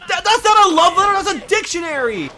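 high, shouted incredulous outburst; incredulous high-pitched exclamation; loud disbelief. Real, not acted. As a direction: yell it, stumbling in amazement. Incredulous reaction to a huge letter.

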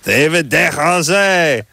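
Desrosiers slow and high, ending in "ay"; French surname. Shown as a demonstration. Host showing off the corrected name, French pronunciation. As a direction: announce Desrosiers as a drawn-out "deh-ro-ZAY".

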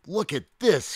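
high, loud show-off shout; boastful high-pitched calling. A narrator's reading. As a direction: call out for everyone to look. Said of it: "he shouted".

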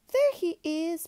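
high, ringing storybook cry; excited cry. A narrator's reading. Patty spotting what seems her dad, crying out high. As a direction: call it out high at a sudden sighting. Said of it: "Patty cried".

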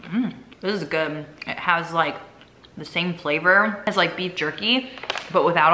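eating a crunchy snack; chewing while talking. Real, not acted. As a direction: mouth partly full. Seen 0: Hand at mouth, jaw working on bitten crisp through early words.

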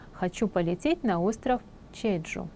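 ordinary talk

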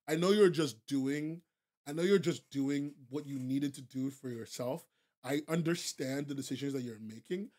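reassuring. Real, not acted. Streamer reassuring a chatter she deserves better, softly. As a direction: ease off and comfort her.

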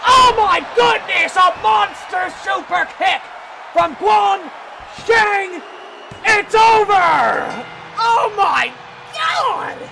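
the match-winning moment, go all out; screaming hype. Real, not acted. Super kick ends the match.

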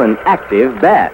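ordinary talk

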